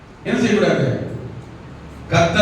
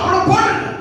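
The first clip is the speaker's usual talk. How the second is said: high-pitched cry for God to step in; urgent plea; 12 semitones higher than usual. Acted. Preacher voicing believers begging God to stop attackers, urgent plea. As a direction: act out a frantic, high appeal.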